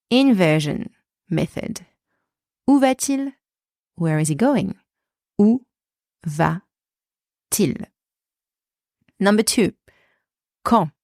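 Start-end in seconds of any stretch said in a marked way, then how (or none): 5.4-7.9 word by word, with long pauses; slow breakdown. Shown as a demonstration. French teacher to beginners, slowly broken down. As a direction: separate each piece for learners.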